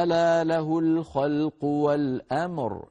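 slow, drawn-out recitation with the final راء held sukun; Quranic recitation demo. Shown as a demonstration. Teacher recites the verse to show the stop. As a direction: recite والأمر slowly, stopping on a still راء. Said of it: "لاحظوا لما وقفنا قلنا".

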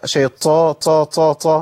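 repeated طا with a puff of air; mistaken aspirated ط. Retold or imitated. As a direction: imitate the faulty airy ط in شيطا. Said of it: "مفيش طا".

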